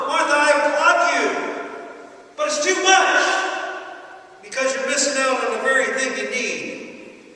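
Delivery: Jesus voiced in a loud preaching build; preaching at full force. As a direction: hammer each Martha line harder.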